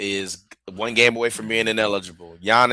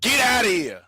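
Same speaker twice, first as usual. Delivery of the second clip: way higher, passionate protest; can't believe anyone would argue this; fired-up defence of a film; worked up; 8 semitones higher than usual. Real, not acted. Arguing Sinners deserves the awards.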